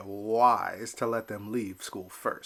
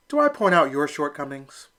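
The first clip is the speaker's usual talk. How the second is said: louder, sharp comeback; snapping back; 4 semitones higher, 6 dB louder than usual. Acted. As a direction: bite back at the catcher. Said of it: "Elmer snapped back".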